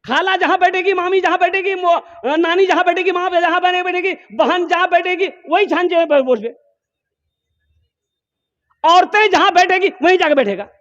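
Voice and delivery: high teasing singsong, mocking lazy youths. Preacher mocks young men who sit among the women, high teasing singsong. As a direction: ridicule them in a high, sneering lilt.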